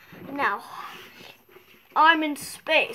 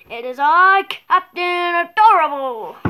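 louder grand superhero announcement; heroic entrance; 7 dB louder than usual. Pretend. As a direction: make the entrance big and proud. Dramatic hero entrance.

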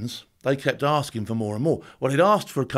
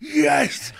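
mock scream; 10 semitones higher than usual. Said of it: "I'm screaming".

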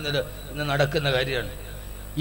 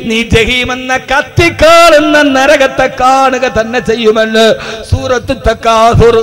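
loud, high sermon at full force; fiery preaching; 7 semitones higher, 14 dB louder, slower than usual. Acted. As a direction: preach it out with burning passion.

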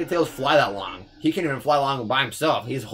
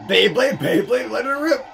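high, loud battle-cry chant climbing upward; rising, hammed-up hype yell; catchphrase shout; 11 semitones higher, 5 dB louder, slower than usual. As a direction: chant it like a hyped cartoon launch call.

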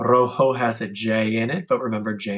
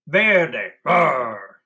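bear growl. Shown as a demonstration. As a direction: roar like a bear. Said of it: "growl like a bear".

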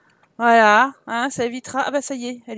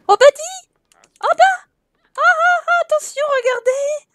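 excited squeal; 14 semitones higher, 7 dB louder than usual. Real, not acted. Squealing at the party guest.

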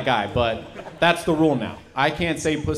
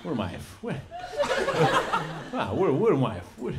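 tripping New York mafioso. Acted. Mobster on mushrooms at dinner, lightly voiced, fading. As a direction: drift off as the mushrooms hit. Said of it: "my impression of a guy in the mafia".

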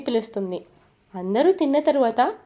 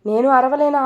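higher, louder angry outburst; angry shout; 4 semitones higher, 6 dB louder than usual. A narrator's reading. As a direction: fire back at her, loud and high. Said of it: "Shiv కూడా fire అవుతాడు". (భార్య మీద కోపంగా అరుస్తూ)

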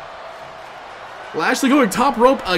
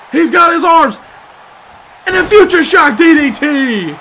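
excited call; 7 semitones higher, 6 dB louder than usual. Real, not acted. Sudden move in the match.

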